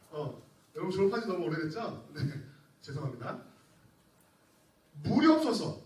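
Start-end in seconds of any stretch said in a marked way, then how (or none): none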